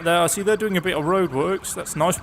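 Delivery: ordinary talk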